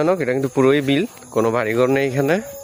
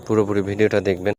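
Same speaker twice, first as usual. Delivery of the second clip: ordinary talk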